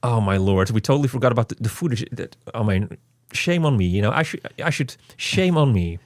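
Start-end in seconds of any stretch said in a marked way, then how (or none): none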